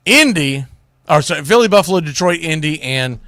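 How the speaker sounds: ordinary talk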